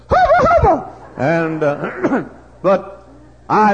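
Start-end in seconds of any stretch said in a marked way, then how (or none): 0.1-1.1 high loud cheer; worn-out holler. Retold or imitated. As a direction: shout it at the top. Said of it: "and I said".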